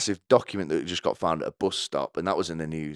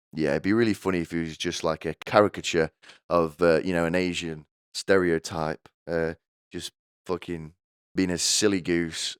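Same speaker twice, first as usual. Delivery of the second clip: usual speaking voice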